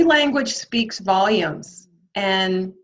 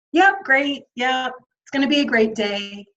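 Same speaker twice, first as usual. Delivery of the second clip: higher, fake-cheerful greeting; dripping in sarcasm; 5 semitones higher than usual. Employee faking it for morning greeters, dripping in sarcasm. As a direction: say it like you don't mean a word. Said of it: "dripping in sarcasm".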